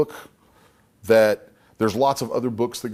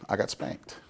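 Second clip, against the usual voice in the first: whisper; 8 dB quieter than usual. Real, not acted. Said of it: "Oh my God".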